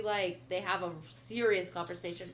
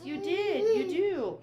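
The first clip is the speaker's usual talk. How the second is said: pleading; 10 semitones higher, 6 dB louder, slower than usual. Acted. Acted plea, he insists.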